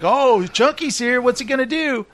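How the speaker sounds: high, hyped-up host imitation; game show host. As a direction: announce the mascot's entrance like a host. Imitates host greeting Chunky.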